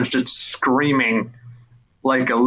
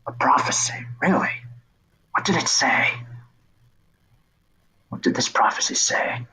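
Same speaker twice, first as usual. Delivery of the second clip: quiet whisper, skeptical; whispered figure; 4 dB quieter than usual. Acted. Figure whispering skeptical questions to the boy. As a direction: ask it hushed and doubtful.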